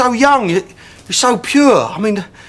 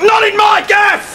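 much higher, slow bellow; outraged shout; 13 semitones higher, slower than usual. Acted. Landlord refusing a student rota, bellowing outrage. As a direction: explode in mock outrage.

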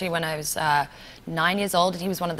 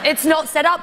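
higher, louder, rising at the end; louder insistence; 9 semitones higher, 7 dB louder than usual. Real, not acted. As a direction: insist again, even harder. Repeat, even louder, rising.

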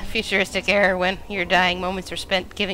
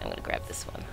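sneaky, softer whisper; 9 dB quieter, faster than usual. Pretend. Sneaks up to the vats.

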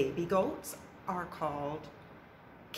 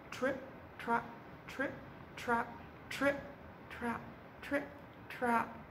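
soft trip trap; 5 semitones higher, 10 dB quieter than usual. A narrator's reading. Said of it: "little goat's footsteps".

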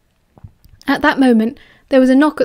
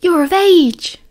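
higher, gleeful announcement; excited cheer; 6 semitones higher, 4 dB louder, slower than usual. A narrator's reading. Peter lunges at Remus with a party hat, excited. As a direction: exclaim it with celebratory lift.

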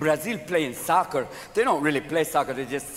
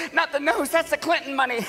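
high mock-alarmed plea; hammed-up high woman's protest; panicked protest; 9 semitones higher than usual. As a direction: yelp it like someone guarding her face. Acts Paula protesting.

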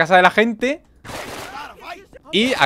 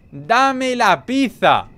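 very slow, higher shout with "pizza" stretched at the end; drawn-out shout; 6 semitones higher, much slower than usual. Pretend. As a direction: drag out "¡Dame la pizza!" like a howl. Repeats the demand even slower.

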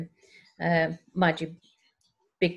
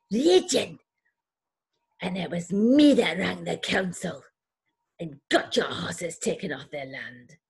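Scottish neighbour; 3 semitones higher than usual. Retold or imitated. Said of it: "she's Scottish, by the way".

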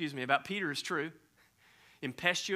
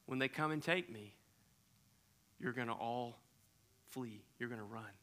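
much softer, low and grave; solemn hush; 5 semitones lower, 11 dB quieter than usual. Acted. Jesus to disciples at supper, solemn quiet warning. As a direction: warn them quietly.

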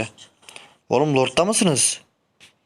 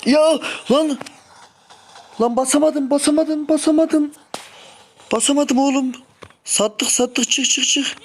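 frustrated outburst; 12 semitones higher, slower than usual. Real, not acted. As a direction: vent at the missed skill. Frustrated outburst after failed skill. (sinirli, tiz feryat)

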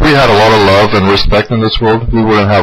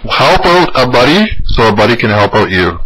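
normal talking voice

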